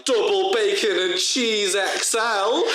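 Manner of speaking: high, drawn-out shouted order; mock screech. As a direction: belt it out like a screech. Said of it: "screeches at the top of his lungs".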